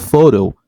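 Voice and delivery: "photo" stressed on PHO; first-syllable stress. Shown as a demonstration. Repeating the example, "photo" stressed on first syllable. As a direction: hit the start of "photo". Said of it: "it's stressed in the first syllable".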